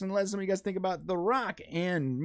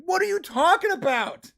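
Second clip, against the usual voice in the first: loud, high-pitched acted protest; mock outburst of incredulity; exasperated disbelief; 9 semitones higher, 6 dB louder than usual. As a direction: fire back baffled, voice jumping up. Acting out exasperated reply to neighbor.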